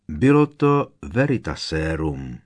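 ordinary delivery

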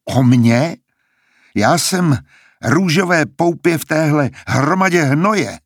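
výš posazený, nevěřícný a výsměšný hlas; vysměj se mu, že tomu nevěříš; nevěřícný posměch. A narrator's reading. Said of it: "se nevěřícně zasmál". (Billy se vysmívá Coriolanovi, nevěřícně a výše)

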